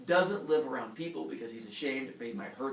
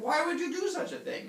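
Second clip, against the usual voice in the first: high, louder comic outrage; appalled accusation; 3 dB louder than usual. Retold or imitated. Villagers confronting Heracles, high scandalized demand. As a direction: throw the question back, leaning on "you".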